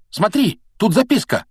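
put-on high, excited boyish call. A narrator's reading. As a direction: call a friend's attention to a sudden find. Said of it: "окликнул его Рон".

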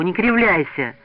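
put-on heated boy taking the group to task; cross scolding. A narrator's reading. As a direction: scold your friends in earnest. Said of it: "сердито дёрнул его за руку".

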